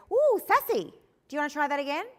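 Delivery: high, loud, teasing ooh sassy; light playful redirection. Shown as a demonstration. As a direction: tease the kid out of their sass, lightly. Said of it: "But I'm gonna do that lightly".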